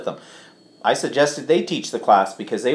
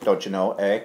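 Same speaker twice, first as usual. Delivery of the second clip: put-on Canadian accent; 6 semitones lower, 6 dB quieter than usual. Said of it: "I have to say it with a Canadian accent".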